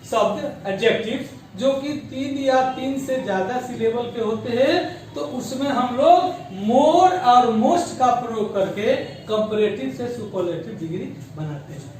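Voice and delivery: usual speaking voice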